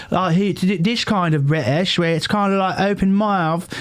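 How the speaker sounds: high open-mouthed British accent. Shown as a demonstration. British accent shown to friends. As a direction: put on a posh British voice, mouth wide open. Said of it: "I apologize for doing that many accents".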